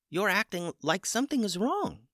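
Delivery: a chicken gently pointing it out; small chicken voice. Acted. Chicken tells the stunned wizard he seems upset, high and innocent. Chicken Hummus replies to Dooley.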